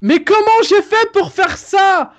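amazed. Real, not acted. Amazed high question.

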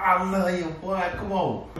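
ordinary delivery

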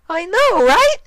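extremely high, loud, bubbly pony; bursting with glee as Derpy; excited Derpy. Acted. Derpy gushing.

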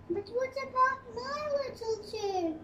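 a lightly voiced upset baby bear; Baby Bear crying out. A narrator's reading. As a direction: pitch it up like a small cub. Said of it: "cried Baby Bear".

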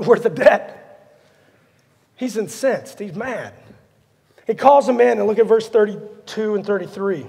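normal talking voice

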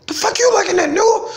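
comic yell pitched very high; mock yell. Heckler on a bicycle yelling from inside the room, high-pitched mock yell. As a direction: put on a high, squawking heckler's holler. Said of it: "somebody yelling at me from inside the room".

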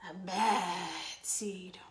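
slow spooky whisper of the words; whispered echo. A narrator's reading. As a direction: breathe it out low and eerie. Echoed in a whisper.

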